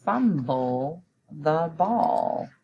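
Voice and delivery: each word slow and separate for writing; spelling-test dictation, slow and clear. Shown as a demonstration. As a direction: dictate it slowly to the class. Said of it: "So the sentence is".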